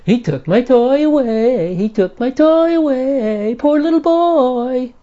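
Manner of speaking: high, slow sing-song taunt; mock the crying kid back in a whiny voice; mocking bully. Playground bully taunting the small child, high mocking whine. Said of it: "the bully, in mockery, says".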